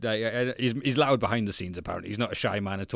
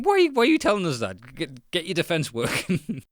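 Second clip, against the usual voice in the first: much higher and louder, half-joking exasperation; exasperated outburst; 8 semitones higher, 6 dB louder than usual. Retold or imitated. Fan yelling at a rival manager, high loud exasperation. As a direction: throw up your hands at a useless manager. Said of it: "I'm like".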